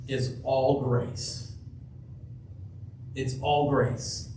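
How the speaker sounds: slow, deliberate repetition; slow emphasis. Real, not acted. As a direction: let each word land slowly. Repeated, slowed emphasis.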